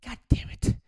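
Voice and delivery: muttered frustration under the breath; fed-up mumbled curse; unvoiced annoyed aside; exasperated groan. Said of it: "I'm like".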